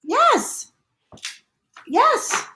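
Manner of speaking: high, insistent repeated yes; emphatic insistence. Real, not acted. Host answering the girlfriend-in-hospital question, emphatically insistent. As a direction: hammer each yes high, with gaps.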